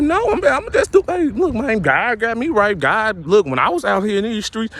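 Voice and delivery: high mocking voice. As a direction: chirp it as a mock of the reformed thug. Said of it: "I'm like".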